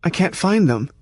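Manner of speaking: put-on a lost child begging to be understood; piteous little girl. A narrator's reading. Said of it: "piteously in a voice like a little girl's".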